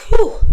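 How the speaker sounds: a big high relieved exhale, loud; relieved whew. Retold or imitated. Telling how the brand's reply brought a whew of relief. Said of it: "sigh of relief".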